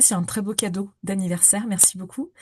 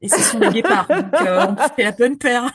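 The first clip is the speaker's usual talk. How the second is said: laughing; 3 semitones higher, 7 dB louder than usual. Real, not acted. Colleague joining in on African team mascots, laughing. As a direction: joke along while really laughing.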